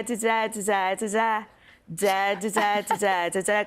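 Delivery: "da, da, da" hummed in a lilting Liverpool rhythm; Liverpool tune. Shown as a demonstration. Voice coach humming the Liverpool accent's tune, lilting. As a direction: sing out the Scouse rhythm on nonsense syllables. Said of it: "doing the tune, like, da".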